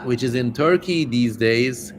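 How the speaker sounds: normal talking voice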